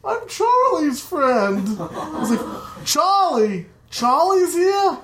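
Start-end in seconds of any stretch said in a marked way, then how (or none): 0.0-1.8 high timid reply, slow; nervous answer. Retold or imitated. Scared friend answering uncle. 2.8-5.0 put-on high, delighted drunk greeting; excited drunk uncle. Drunk uncle excited to see him.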